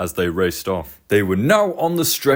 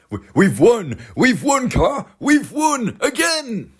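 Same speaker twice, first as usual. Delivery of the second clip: excited cheer; 11 semitones higher, slower than usual. Acted. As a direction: celebrate winning the race. Driver celebrates win.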